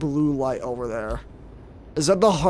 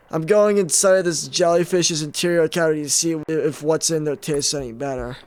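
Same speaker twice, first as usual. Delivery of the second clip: ordinary talk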